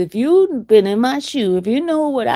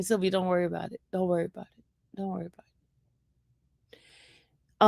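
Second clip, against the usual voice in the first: soothing repeated reassurance; 8 dB quieter, much faster than usual. Retold or imitated. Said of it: "And he kept saying".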